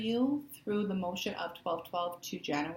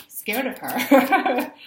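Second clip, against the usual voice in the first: mock scared; 5 semitones higher, 13 dB louder, much slower than usual. Real, not acted.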